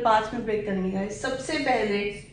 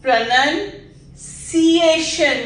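"pronunciation" slow and a bit louder; slow careful model; 2 semitones higher, 5 dB louder, much slower than usual. Shown as a demonstration. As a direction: model it syllable by syllable. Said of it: "join कीजिए".

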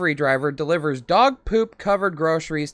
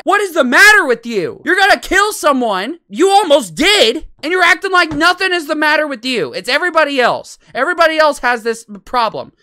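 high, loud indignant scolding; very heated; 8 semitones higher, 6 dB louder than usual. Real, not acted. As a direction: berate them in real exasperation. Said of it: "I have been getting very heated".

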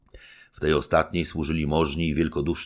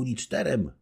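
higher, playful counting up; young wife teasing; 9 semitones higher than usual. Acted. Young wife bargaining with her husband, higher and playful. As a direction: tease him lightly. Baśka voiced by reader.

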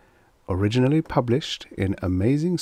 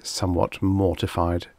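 usual speaking voice

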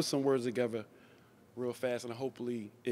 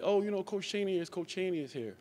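high, eager mock excitement; imitating guys announcing the coach's arrival, excited; 7 semitones higher than usual. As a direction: play the thrilled neighbourhood guys. Neighbourhood guys excited about visitor.

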